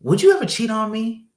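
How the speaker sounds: high mock voice of his girlfriend; imitating girlfriend. Girlfriend asking him a hard question, in a high mock voice. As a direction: play her asking, voice lifted. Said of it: "So she's like".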